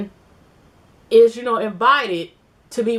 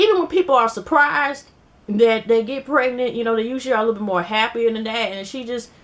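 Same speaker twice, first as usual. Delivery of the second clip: usual speaking voice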